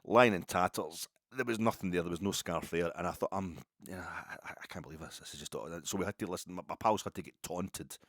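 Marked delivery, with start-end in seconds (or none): 3.3-5.5 hushed, halting inner voice; quiet disbelief. Retold or imitated. Teenager to himself over a lost scarf, quiet disbelief. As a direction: murmur it to yourself, trailing away. Retelling his disbelief at the loss.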